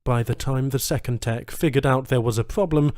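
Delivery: ordinary delivery, nothing marked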